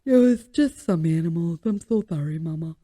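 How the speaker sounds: a hint of a sorry pup apologizing; Buster's voice. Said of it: "that's what he looks like when he talks".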